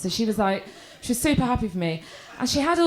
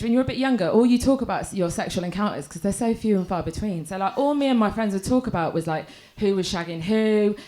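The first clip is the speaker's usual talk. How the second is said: ordinary talk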